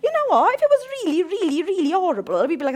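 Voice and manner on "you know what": high, loud teasing preacher; high excited teasing; playful excitement. Real, not acted. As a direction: egg them on with a grin. Preacher teasing congregation about sin.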